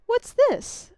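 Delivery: high, sudden startled question. Acted. Spirit startled by something in her robe, high. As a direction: caught off guard by something.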